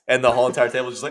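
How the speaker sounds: usual speaking voice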